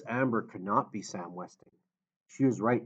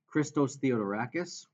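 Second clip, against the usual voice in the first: usual speaking voice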